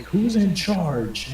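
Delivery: high, very slow drawn-out demand; menacing demand. Retold or imitated. Elf barbarian demanding the tavern's leader, slow and menacing. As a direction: drag out every word as a threat.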